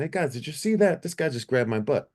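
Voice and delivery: high-pitched, incredulous retold complaint; indignant appeal. Singer to his band after a bouncer's grab, indignant. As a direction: call on witnesses in high disbelief.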